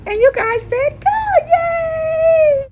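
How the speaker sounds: high, loud, excited cheer. Real, not acted. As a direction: cheer the viewers on with a happy yay. Cheering viewers on after finishing.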